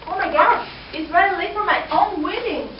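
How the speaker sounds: hammed-up bride rushing off to her wedding; mock panic. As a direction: pretend to realise you're late. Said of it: "I'm so late".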